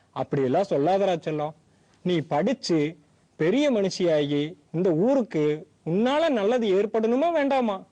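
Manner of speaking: put-on old grandfather coaxing a crying granddaughter; kind old grandfather. Grandfather figure voiced.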